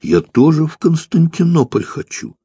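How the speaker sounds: high pleading whine; whining wail. A narrator's reading. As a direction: wail it like a sulky boy. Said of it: "взвыл прапорщик".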